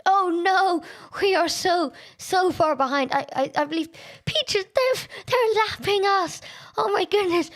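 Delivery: high, rising alarm; exasperated high-pitched distress; dismayed panic. Real, not acted. Realises they are being lapped.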